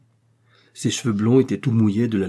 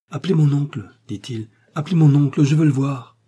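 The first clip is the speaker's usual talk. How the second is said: raised, higher pleading call; insistent demand; 5 semitones higher, 5 dB louder than usual. A narrator's reading. Delirious patient calls for his uncle, insistent. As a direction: demand it urgently from a sickbed.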